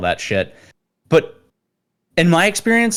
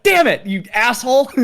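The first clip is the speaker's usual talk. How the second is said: high, loud mock-exasperated instructor voice; frustrated instructor; 14 semitones higher, 4 dB louder than usual. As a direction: play the beaten sergeant, hammed-up. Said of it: "And he's like".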